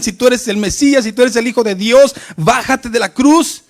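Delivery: mock crowd jeer building to a shout; mocking taunt. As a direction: taunt him like a scornful crowd. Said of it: "se burlaban y le decían".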